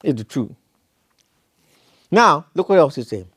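usual speaking voice

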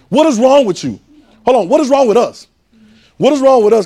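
loud, high exasperated cry; what is wrong with us, called out loud and high; exclaiming. Acted. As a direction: shout it at the congregation in disbelief. Preacher exclaiming to congregation.

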